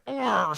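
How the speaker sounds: loud, high mock roar of frustration; imitated angry growl. Imitating the supervisor getting all angry, loud frustrated growl. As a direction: let out an exaggerated angry groan. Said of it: "So he gets all angry".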